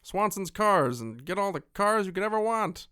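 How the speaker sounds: high, loud hammed-up car salesman; goofy dealership pitchman voice; cheesy car dealer. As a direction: shout the deal like a local ad. Continuing mock car ad.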